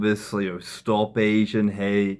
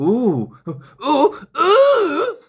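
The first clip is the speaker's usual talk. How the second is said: rising squeals, each one much higher and louder; mock excited ooh; 6 dB louder, much slower than usual. Seeing a cool car drive past, rising mock squeals. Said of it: "a cool car drives past and you go".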